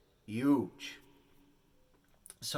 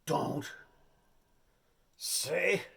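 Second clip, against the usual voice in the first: hammed-up director, slow and halting; a hint of a dramatic director giving orders; 5 semitones higher, 4 dB louder, slower than usual. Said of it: "He says".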